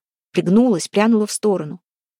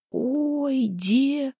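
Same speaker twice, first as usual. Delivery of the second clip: hoarse drawn-out moan, pitched up; 7 semitones higher, 3 dB quieter than usual. A narrator's reading. Харапун starts to exclaim before his mate covers his mouth, hoarse. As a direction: groan it out hoarsely, voice raised in pitch. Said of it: "хрипло начал Харапун".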